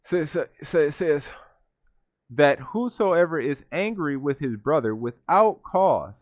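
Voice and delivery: usual speaking voice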